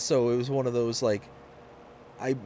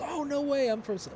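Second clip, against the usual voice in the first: high mock surprise. Retold surprise.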